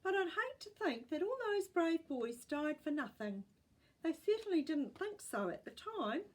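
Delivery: put-on a touch of an elderly great-gran remembering; old great-gran. A narrator's reading. Great-gran telling the family about brave boys, wistful old voice.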